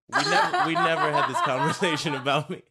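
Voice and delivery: high, loud, laughing Geordi impression. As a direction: crack up while playing Geordi.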